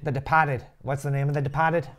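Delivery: mock Boston accent, dropping the R's; playful put-on Boston accent. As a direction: echo it as "De-pah-ted", Boston style. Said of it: "really bad Boston accent".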